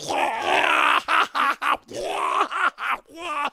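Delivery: long, high mock retching; exaggerated throwing-up noises; imitated puking. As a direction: heave up noisy comic vomit. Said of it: "she's like".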